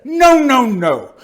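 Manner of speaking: loud, high, falling string of noes; exasperated objection. Acted. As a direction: protest loudly, starting high and coming down. Exasperated interlocutor rejects answer.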